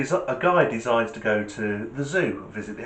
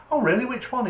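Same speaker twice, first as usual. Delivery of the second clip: high-pitched, curious keeper; lifted, questioning reply; mild surprise; 12 semitones higher, faster than usual. Acted. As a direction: act politely taken aback.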